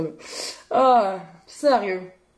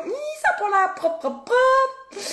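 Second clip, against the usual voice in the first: high, quick disgusted squeal; mock disgust; 13 semitones higher than usual. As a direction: squeal it like you're grossed out. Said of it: "T'es comme". (dégoût moqueur, voix aiguë)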